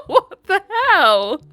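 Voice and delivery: high, loud words through laughter; real incredulous laughter. Real, not acted. Reader reacting to a boyfriend's absurd text, laughing incredulously. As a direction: laugh out a slow, disbelieving line.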